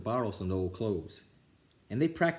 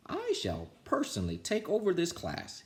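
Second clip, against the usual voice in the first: higher, stiff principal voice; higher pompous principal; 9 semitones higher than usual. Acted. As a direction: announce it grandly.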